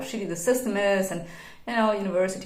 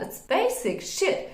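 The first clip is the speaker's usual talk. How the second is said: exasperated; 11 semitones higher, slower than usual. Real, not acted. Exasperated emphasis.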